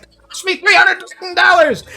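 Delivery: very high, loud, strained outraged yell; furious screech. Acted. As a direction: scream it at your wife in a rage. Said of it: "He's like".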